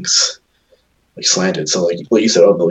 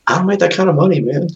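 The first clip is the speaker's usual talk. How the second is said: indignant; 11 semitones higher than usual. Retold or imitated. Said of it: "I was like".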